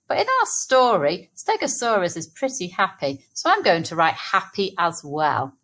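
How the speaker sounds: usual speaking voice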